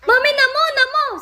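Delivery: put-on very high, squeaky baby call; mother imitating her toddler calling for water. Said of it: "Sabi niya sa akin".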